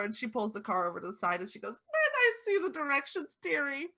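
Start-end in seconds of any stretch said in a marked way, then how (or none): 1.9-3.9 put-on old lady voice.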